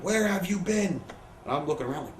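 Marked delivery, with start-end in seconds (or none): 0.0-1.0 high-pitched imitation of a boss yelling; retold angry yell. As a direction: demand an answer, imitating an irate boss. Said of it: "Vince starts yelling".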